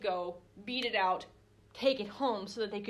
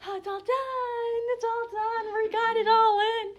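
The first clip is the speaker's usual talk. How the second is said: relieved, carefree voice; 12 semitones higher, 7 dB louder than usual. Retold or imitated. Harvesters relaxing after the harvest, high carefree voice. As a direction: sing it out high like the work is over. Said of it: "a different emotional context".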